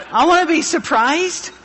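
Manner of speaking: playful retort. Retold or imitated. Jokey retort to his wife.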